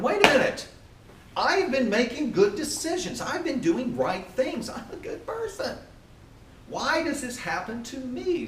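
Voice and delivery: high-pitched, incredulous complaint; indignant protest. Acted. Acting out indignant good person's complaint.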